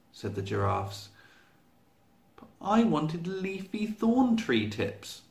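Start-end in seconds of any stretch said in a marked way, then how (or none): 2.4-5.2 high, slow cross complaint; cross shout. Acted. Giraffe complaining to the giraffes about dinner, high cross shout. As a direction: whine it out crossly. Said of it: "shouted Giraffe crossly".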